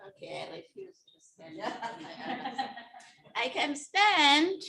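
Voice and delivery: chuckling through the words; laughing. Real, not acted. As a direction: laugh while you speak. Laughing while declining to stand.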